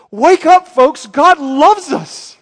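loud, high rousing cry; calling out. Real, not acted. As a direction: shout to jolt them awake. Preacher calling out to congregation.

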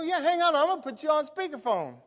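high, loud, upbeat retelling; eager phone reply. Retold or imitated. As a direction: act out keeping a caller on the line. Retold excited reply to caller.

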